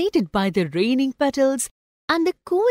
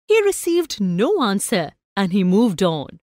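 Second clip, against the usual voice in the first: plain reading voice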